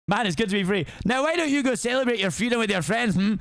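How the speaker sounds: put-on Shrek in an aggressive Scottish accent, sarcastic. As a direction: brush off the donkey in a Scottish accent. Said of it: "Scottish accent on for every single time".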